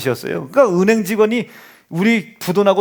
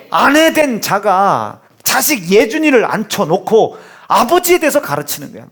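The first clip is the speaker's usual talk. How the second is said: ordinary delivery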